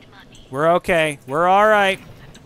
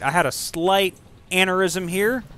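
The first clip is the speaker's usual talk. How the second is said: ordinary delivery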